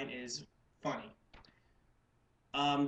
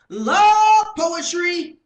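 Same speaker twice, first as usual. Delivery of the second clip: very high, very loud, drawn-out yell; full-force slam-poetry shout; shouted poetry punchline; 21 dB louder, much slower than usual. Acted. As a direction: bellow it slowly in theatrical outrage. Second, shouted take of the hook.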